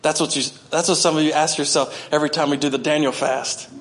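ordinary talk, nothing marked

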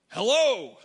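loud, exaggerated tourist call. Tourist in a German shop trying to be understood, extra loud. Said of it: "You say it louder so that everybody can understand".

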